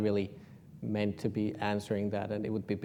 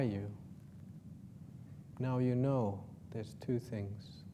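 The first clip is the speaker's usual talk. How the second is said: guided meditation; 8 dB quieter than usual. Acted.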